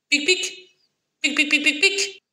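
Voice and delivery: very high squeaky pics; squeaky squirrel pic. A narrator's reading. Storyteller squeaking for the squirrel.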